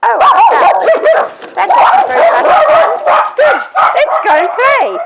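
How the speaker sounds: very high, loud cartoon puppy; imitated puppy bouncing back, high and loud; puppy's voice. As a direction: squeal it as a carefree puppy. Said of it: "Five seconds later they go".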